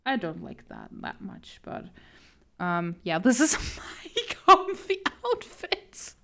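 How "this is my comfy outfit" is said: laughing. Real, not acted. Laughs while describing.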